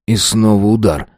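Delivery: ordinary delivery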